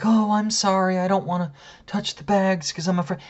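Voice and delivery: put-on high, timid old lady voice; lightly put-on voice of a polite, apologetic old lady. Imagined old lady excusing herself to a customer, high and apologetic. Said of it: "an old lady or something like that".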